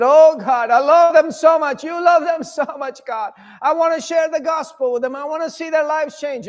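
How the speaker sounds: high, loud, weeping plea to God; tearful prayer. Acted. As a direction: pray through tears, full of love. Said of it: "like that, you know, full of love, tears".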